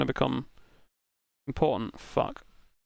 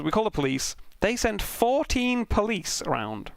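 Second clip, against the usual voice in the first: usual speaking voice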